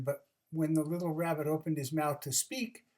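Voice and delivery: ordinary delivery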